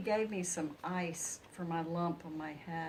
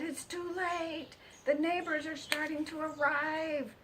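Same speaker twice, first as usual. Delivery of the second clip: despairing pig voice; 10 semitones higher than usual. Acted.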